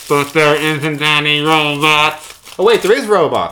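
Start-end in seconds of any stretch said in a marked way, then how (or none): 0.1-2.3 loud, slow monotone through foil; robot voice. Shown as a demonstration. Trying out the new D and D character, robot voice. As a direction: drone it out like a high-pitched robot. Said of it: "you've got a robot character".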